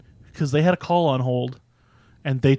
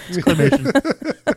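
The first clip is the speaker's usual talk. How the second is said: mock announcer drawl; 11 semitones higher, 4 dB louder, slower than usual. Joking about effects.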